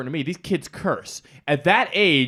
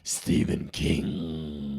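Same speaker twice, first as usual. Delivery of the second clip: very slow, low, ominous; spooky horror voice; 11 semitones lower, 6 dB quieter, much slower than usual. Pretend. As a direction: drag it out like a horror trailer. Spooky name echo.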